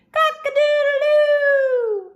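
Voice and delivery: high, slow sung rooster call; rooster crow. Shown as a demonstration. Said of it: "What bird goes".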